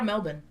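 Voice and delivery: Melbourne said as MEL-bun, without r; Australian-style pronunciation. Retold or imitated. Quoting the Uber driver's answer, Australian-style pronunciation. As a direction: say Melbourne with a short "bun" ending. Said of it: "That's how it's pronounced".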